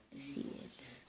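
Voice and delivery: hushed whisper to self. Real, not acted. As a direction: breathe the words under your breath. Unvoiced whisper while playing.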